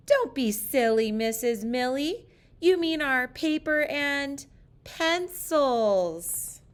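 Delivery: put-on playful kids' scolding, slowed down; children correcting teacher. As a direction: drag it out like a class teasing teacher.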